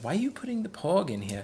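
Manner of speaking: high-pitched imitation of a little girl's British-sounding accent; daughter's voice. Dad quoting his four-year-old daughter at bedtime, imitated child voice. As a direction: mimic a small daughter, pitched well up. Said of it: "my daughter's like".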